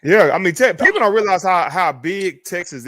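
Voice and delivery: emphatic insistence. Real, not acted. Insisting on Texas size.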